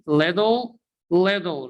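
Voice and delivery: little said as liddle; American flap t. Shown as a demonstration. Teacher repeating for learners, d sound in little. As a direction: turn the tt in little into a d.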